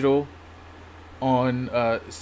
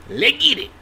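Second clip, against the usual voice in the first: hyped up; 12 semitones higher, 6 dB louder than usual. Real, not acted. Hype before starting.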